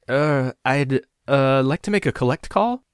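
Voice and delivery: high, loud, halting request; at a loss. A narrator's reading. Caller caught out by the operator, flustered stammering. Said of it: "I didn't know what to say".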